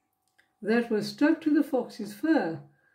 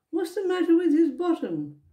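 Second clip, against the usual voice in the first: higher, baffled asking; puzzled question; 7 semitones higher, faster than usual. A narrator's reading. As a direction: ask it genuinely baffled. Percy puzzled follow-up question.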